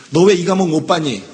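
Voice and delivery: loud, pointed demand why you failed; mock scolding question. Teacher demanding why the student failed the exam, loud scolding question. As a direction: bark the question like a stern teacher.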